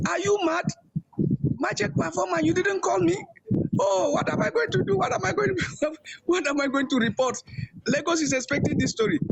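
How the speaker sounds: high, alarmed retelling of a panicked boss; retold panic. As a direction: voice him jumping up in alarm, pitched high. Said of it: "was looking terrified".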